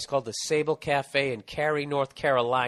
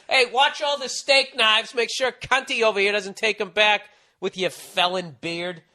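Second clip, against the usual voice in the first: much higher, louder mock boss warning; mock manager; 8 semitones higher, 5 dB louder than usual. Comic voicing a manager about the felon cook, mock warning. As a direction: bark it like a nervous manager on the floor.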